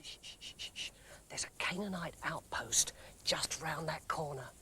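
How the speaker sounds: whispering a warning about the Canaanite outpost; urgent whisper. Acted. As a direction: hiss them quiet before the enemy hears. Hushing near enemy outpost.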